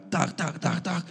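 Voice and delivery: imitating a stranger knocking on the car window, softly; knocking sound. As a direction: tap out the knock with your voice.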